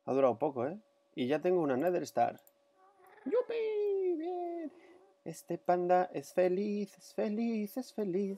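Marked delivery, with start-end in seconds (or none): 3.2-4.7 high-pitched gleeful cheer; squeal of joy. Real, not acted. Celebrating Nether Star. 5.2-8.4 high, slow playful singsong; sing-song. Pretend. Happy panda sing-song.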